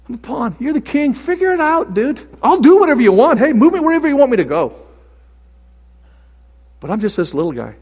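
high, loud, eager offering to be moved; a little pawn throwing himself on the king; excited pawn prayer. Retold or imitated. Preacher playing a pawn, eager and loud.

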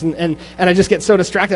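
ordinary talk, nothing marked

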